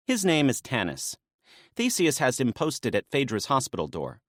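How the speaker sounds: lightly voiced woman, brisk and businesslike; a hint of a maenad laying out the plan; briefing maenad. Naya briefing Dionysus on the rescue plan, lightly voiced.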